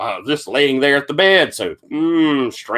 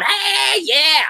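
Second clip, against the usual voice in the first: [name] whooping. Acted. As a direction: cheer like a wild rocker. [name] pops up partying.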